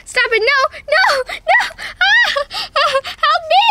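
very high, loud laughing screams; squealing laughing shrieks. Pretend. Toy girl shrieking under the puppy's belly-rub attack. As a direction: shriek for help through laughter. Dog tickling, cries for help.

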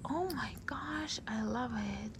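breathless delighted whisper. Real, not acted. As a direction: whisper it in awe. Gasps over sparkly lipstick.

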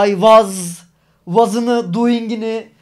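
I was and doing pushed out hard, word by word; over-articulated English words. Shown as a demonstration. Mimicking Turks forcing out each English word, I was and doing over-pronounced. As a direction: sound out every ending of was and doing, loudly. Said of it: "her cümleyi çıkartmaya çalışıyoruz".

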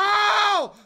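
ordinary delivery

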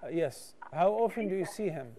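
ordinary talk

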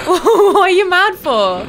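high loud laughing yell; yelling and laughing at an angry enemy; laughing shout. Real, not acted. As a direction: laugh through the panic. Laughing while fleeing enemy.

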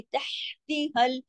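slow तहती with the h made in the throat. Shown as a demonstration. Teacher contrasts two h sounds, तहती recited with throat h. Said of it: "ये गले से पैदा हुई".

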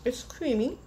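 ordinary talk, nothing marked

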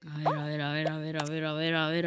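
soft tense murmur; whisper-watch the play; hushed tension. Real, not acted.

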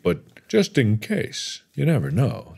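ordinary delivery

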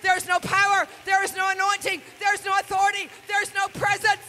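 high, shouted pulpit exhortation; full-force preaching. Real, not acted. Preacher warning young people against the lie, shouted exhortation. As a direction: thunder each line at the congregation.

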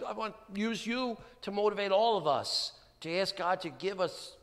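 ordinary delivery

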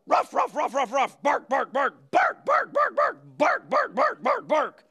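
yappy pretend barks, some high; dog barking. Shown as a demonstration. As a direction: bark like a little dog. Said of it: "Bark like a dog".

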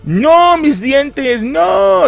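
high, loud, hammed-up cry over lost fangs; mock wail. Dracula grieves his fangs, high hammed-up wail. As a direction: wail like a vampire losing his teeth.

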